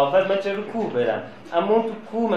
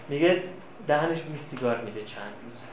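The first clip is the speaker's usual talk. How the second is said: ordinary talk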